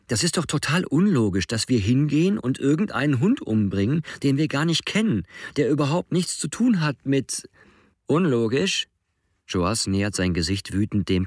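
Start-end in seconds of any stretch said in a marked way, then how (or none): 0.1-7.5 agitated pleading. A narrator's reading. Alex pleading, pitched high. 8.1-8.9 mocking echo. A narrator's reading.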